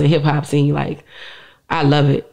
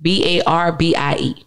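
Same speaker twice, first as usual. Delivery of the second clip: slow, letter-by-letter spelling; slower than usual. Real, not acted. Guest giving listeners her Instagram name, spelling slowly. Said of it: "So that's".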